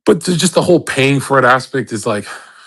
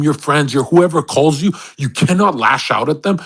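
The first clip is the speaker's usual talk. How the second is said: normal talking voice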